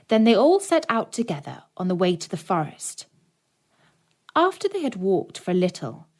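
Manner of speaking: usual speaking voice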